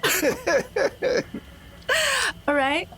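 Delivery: high laugh cutting off the word; laughing too hard to finish; words lost in a high giggle; burst of laughter. Real, not acted. Player breaks into laughter.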